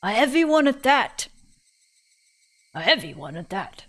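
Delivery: put-on British old lady holding forth; old woman making her point, swinging in pitch; old person, British. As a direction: voice an opinionated British granny. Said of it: "all my old people voices turn British".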